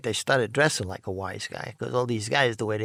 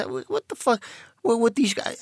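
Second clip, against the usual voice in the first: mock startled buddy; 10 semitones higher than usual. Charlie reacting, taken outside.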